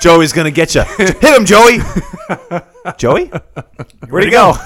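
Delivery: high, loud excited cheer. Acted. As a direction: cheer him on, laughing. Cheering on invisible Joey.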